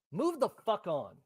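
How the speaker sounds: ordinary delivery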